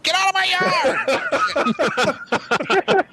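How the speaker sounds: very high, screechy mock homeowner; outraged lady. Imitating lady chasing kids off.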